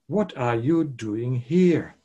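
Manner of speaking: slow question landing hard on "here"; stress on HERE. Shown as a demonstration. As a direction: draw out the question, weight on "here". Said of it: "the emphasis is on the word here".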